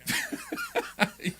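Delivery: a high burst of laughter; laughing. Real, not acted. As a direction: laugh freely at the joke. Guest laughs at joke.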